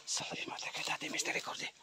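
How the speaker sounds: barely audible voiceless whisper; whispered incantation. Real, not acted. Ghost hunter praying against an angry creature, whispered incantation. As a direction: whisper the prayer under your breath.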